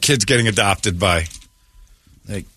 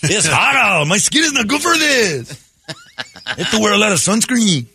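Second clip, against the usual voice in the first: mock Swedish accent; 8 semitones higher than usual. [name] as a Swedish adoptee in Arizona, hammed-up Swedish lilt. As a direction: play the pale Swede complaining about the sun. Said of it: "why do I feel like you're doing an accent".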